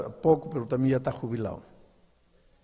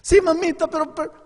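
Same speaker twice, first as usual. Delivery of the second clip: put-on pleading boy; 8 dB louder than usual. Son begs mother to spare him.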